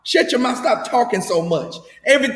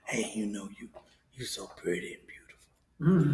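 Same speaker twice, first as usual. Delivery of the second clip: much lower, much softer seductive hush; late night DJ voice; 11 semitones lower, 14 dB quieter than usual. Retold or imitated. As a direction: murmur it like a radio seducer. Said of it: "sit there and whisper".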